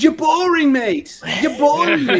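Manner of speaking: loud mock Aussie heckle, high-pitched; Australian accent. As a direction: jeer in a put-on Australian accent. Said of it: "You've been watching too much Az, you guys".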